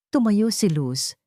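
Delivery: ordinary delivery, nothing marked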